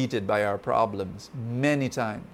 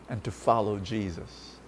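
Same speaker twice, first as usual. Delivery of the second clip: ordinary talk, nothing marked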